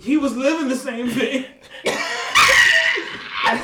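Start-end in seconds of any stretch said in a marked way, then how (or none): 1.7-3.4 high, shrieking burst of laughter; a high shout of a laugh. Real, not acted.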